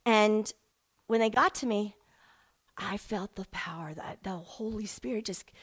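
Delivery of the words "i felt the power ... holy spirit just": hushed whisper full of awe; soft reverent whisper; awed whisper. Real, not acted. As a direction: breathe it out as if it's sacred. Awed retelling of God encounter.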